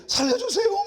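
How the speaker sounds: panicked reenacted shout for rescue; cry for help. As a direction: yell it like you're being swept away. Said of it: "막 나도 그랬어요".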